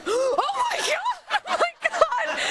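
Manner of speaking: high, loud laughing panic; new mother jolted awake in alarm; mock panic. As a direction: panic as the baby is found. Waking in panic, baby fell.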